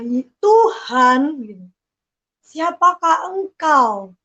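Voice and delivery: slowly drawn-out plea to God; slow pleading prayer. Retold or imitated. A woman calls slowly to God as she weeps. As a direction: ask it slowly, as if crying out to God.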